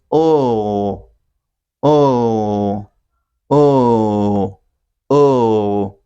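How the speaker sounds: long, drawn-out 'oh' for the dark L; dark L sound. Shown as a demonstration. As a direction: repeat a long falling 'oh', one at a time. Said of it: "like this".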